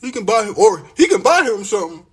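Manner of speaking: high, loud mock voice of careless women; imitating the women brushing him off. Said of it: "You know what they got on their mind?".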